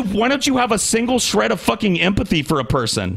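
high-pitched, exasperated demand; angry accusation. Real, not acted. Podcaster demanding empathy from his co-host, exasperated. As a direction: plead angrily, voice pitched well up.

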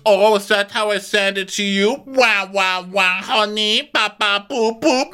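high, sing-song jeer; hammed-up baby-noise taunt; mocking retort. [name] throwing the wahs back at Sutton, mocking retort.

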